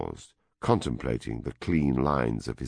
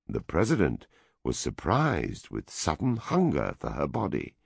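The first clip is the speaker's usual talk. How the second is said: ordinary delivery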